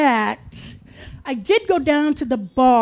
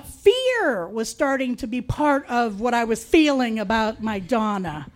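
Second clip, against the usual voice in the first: ordinary talk, nothing marked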